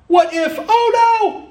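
mock panic, shrieking high; hammed-up fearful squeal on oh no; panicky what if. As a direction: squeal it like a frantic worrier. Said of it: "This is who I am".

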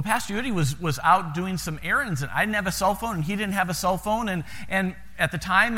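ordinary delivery

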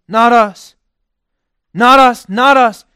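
loud, high, insistent repetition; forceful preacher's refrain; emphatic cry. Acted. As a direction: hammer it home each time. Emphatic repeated denial.

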